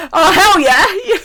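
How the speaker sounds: loud, high, drawn-out re-enacted delight; eager acceptance. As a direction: relish the yes, laughter creeping in. Retold eager answer to offer.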